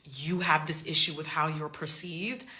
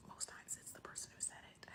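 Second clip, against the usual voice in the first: breathy whispered truth; whispered aside; 23 dB quieter than usual. Real, not acted. Telling viewers the real reason, in a whisper. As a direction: whisper it like a secret.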